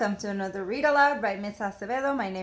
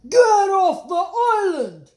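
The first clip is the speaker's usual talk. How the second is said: loud, high frog shout; full high shout; 10 semitones higher, 11 dB louder than usual. Acted. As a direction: bark it as a bossy frog. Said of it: "shouted Rupert".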